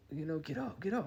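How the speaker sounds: high mock girlfriend voice; upset girlfriend. Girlfriend to him lying hurt, high urgent imitation. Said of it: "my girlfriend at the time".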